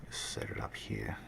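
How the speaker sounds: quiet working mutter; whispered aside. Real, not acted. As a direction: murmur it to yourself while clicking. Muttering while placing tiles.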